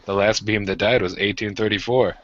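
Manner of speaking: ordinary talk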